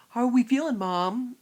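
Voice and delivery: put-on loud, hearty older-guy greeting; imitated older man. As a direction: boom it out like a nosy old man. Imitates older man behind her.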